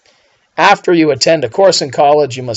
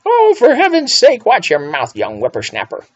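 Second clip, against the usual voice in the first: mock scold; 9 semitones higher than usual. Teacher mock-scolding a bad sentence.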